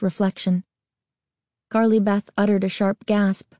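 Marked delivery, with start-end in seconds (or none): none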